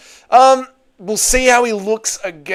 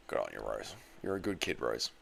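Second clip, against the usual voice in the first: deep mock voice; 21 dB quieter than usual. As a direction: voice dropped far lower and softer.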